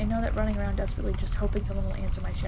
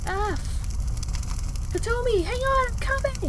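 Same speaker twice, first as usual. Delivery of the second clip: high panicked yell in a different voice; loud panicked voice; 14 semitones higher, much slower than usual. Acted. Rescuer yells to Kotomi through flames, panicked. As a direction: panic as you rush to help. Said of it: "a loud, panicked voice".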